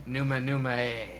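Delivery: serious poetry voice. Acted. Nervous performer reciting at a poetry slam, serious poetry voice. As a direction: deliver nonsense lyrics as solemn verse. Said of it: "the most serious poetry voice you can".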